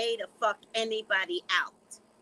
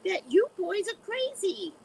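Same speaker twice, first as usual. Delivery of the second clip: much higher, slow mock-stern voice; imitating Kiki; 13 semitones higher, 4 dB louder, slower than usual. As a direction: play Kiki scolding Darius, high and slow. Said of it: "She says".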